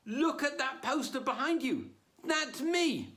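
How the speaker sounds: high-pitched, exasperated insistence; indignant protest. Retold or imitated. Comic protests to doorman.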